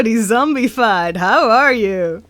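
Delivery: usual speaking voice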